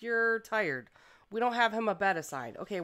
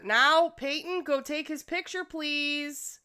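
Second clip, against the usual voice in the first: normal talking voice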